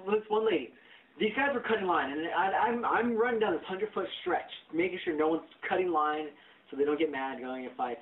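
normal talking voice

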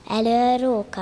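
usual speaking voice

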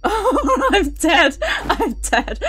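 high, frightened words broken by laughter; nervous laughing. Real, not acted. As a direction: laugh helplessly through the fear.

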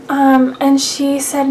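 normal talking voice

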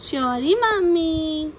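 put-on slow, drawn-out sorry mummy, spelled out for a toddler; mother modelling sorry mummy slowly for her toddler; slow model for child. Shown as a demonstration. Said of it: "sorry बोलो".

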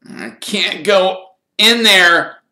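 loud, high, slow protest; gruff. A narrator's reading. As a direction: drag out a gruff refusal, loudly. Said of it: "said Ron gruffly".

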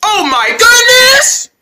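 high, loud, drawn-out cry; exclaiming outburst. Acted. Vendor bursting out amid the begging, exclaiming. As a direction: blow up on a long, high GOODNESS.

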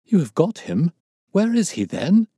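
loud, high astonished questions; startled, urgent demand; amazed. A narrator's reading. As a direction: burst out in eager disbelief. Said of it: "stared at him in amazement".

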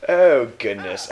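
mock British dismay; British accent. Player slipping into banned voice, British accent. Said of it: "Stop speaking in a British accent".

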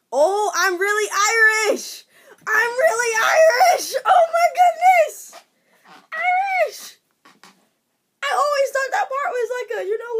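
high, loud, tearful shout of real joy; overwhelmed, half shouting half crying. Real, not acted. Learning she really is Irish, a joyful tearful shout.

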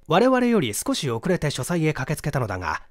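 ordinary delivery, nothing marked

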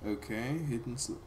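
ordinary talk, nothing marked

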